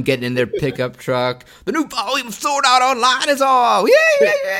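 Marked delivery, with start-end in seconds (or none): 1.6-4.6 high excited squeal rising; giddy anime fan, loud and high; excited nerd voice. Acted.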